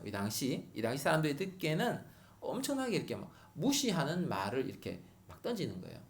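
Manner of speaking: normal talking voice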